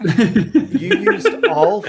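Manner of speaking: reading it out high, loud and slow through a laugh; bursting into laughter mid-question. Real, not acted. Host reading a fan question aloud, laughing loudly.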